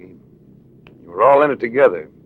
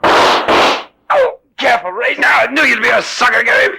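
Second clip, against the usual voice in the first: high, loud frantic shouts; panicked shouting during a scuffle; shouting in a struggle; 12 semitones higher, 5 dB louder, slower than usual. Acted. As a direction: yell it out mid-fight.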